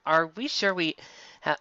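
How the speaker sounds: usual speaking voice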